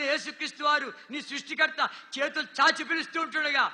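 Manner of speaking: loud, urgent sermon delivery; fervent pulpit preaching; full-force preaching. Acted. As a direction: preach it hard to the crowd. Preaching altar call.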